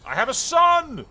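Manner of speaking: very high, loud, drawn-out cry of triumph; elated shout. Real, not acted. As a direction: shout it out in long-awaited relief. Overjoyed at the birth of a son.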